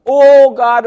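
high, loud "Oh God"; panicked cry. Retold or imitated. People in an emergency crying out, high and loud. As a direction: blurt it out like someone in trouble. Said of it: "What do they say?".

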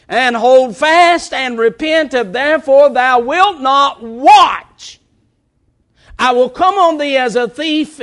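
high, measured pulpit proclamation; forceful scripture preaching. Real, not acted. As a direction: declaim the warning loudly and deliberately.

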